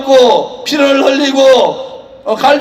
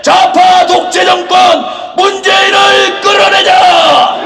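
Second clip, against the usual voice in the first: shouted rally slogans, higher pitch; roaring rallying speech; 5 semitones higher than usual. Acted. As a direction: rouse the crowd with chants. Rally leader chanting to crowd.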